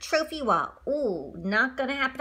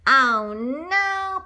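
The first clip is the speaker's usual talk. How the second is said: plain reading voice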